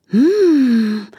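long high "mmm" gliding down; savouring hum of delight; blissful hum. A narrator's reading. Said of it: "machte Charlotte mit einem schaumigen Milchbart".

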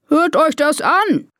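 high, drawn-out elephant announcement; trumpeting elephant. Acted. Elephant chief addressing his herd, trumpeting. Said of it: "trompetet Karl".

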